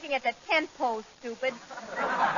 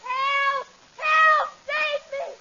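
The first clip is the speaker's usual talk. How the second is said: cries for help; 12 semitones higher, much slower than usual. Acted. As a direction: shriek for rescue from the tent. Captive princess calling for help.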